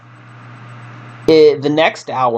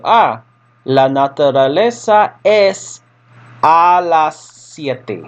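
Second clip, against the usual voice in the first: slow, drawn-out answer; 5 semitones higher, much slower than usual. Retold or imitated. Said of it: "you look at the T V guide and you go".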